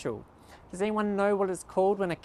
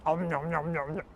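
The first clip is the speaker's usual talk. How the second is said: nom nom chomping; 4 semitones lower, 5 dB quieter than usual. Pretend. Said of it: "Can you make a munching sound?".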